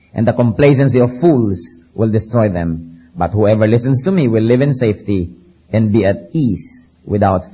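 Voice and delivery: slow, weighty Bible reading with big rises and falls; dramatic scripture reading. Acted. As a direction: read the warning like a prophet. Reads Proverbs scripture dramatically. (madamdaming pagbasa ng Banal na Kasulatan)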